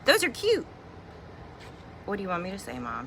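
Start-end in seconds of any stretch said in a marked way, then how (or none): 0.0-0.7 put-on enthusiastic teen. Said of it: "You're not like".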